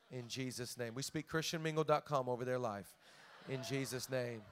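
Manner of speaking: soft, low praying voice over the church; hushed prayer. Acted. Pastor praying softly over single people. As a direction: pray it gently and low.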